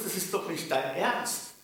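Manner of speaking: normal talking voice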